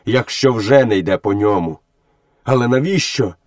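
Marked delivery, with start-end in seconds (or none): 2.4-3.3 indignant questions, climbing high at the end; angry protest. A narrator's reading. Mowgli protests that people drove him out, angrily. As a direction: build to a high-pitched, cross demand. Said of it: "сказав Мауглі сердито".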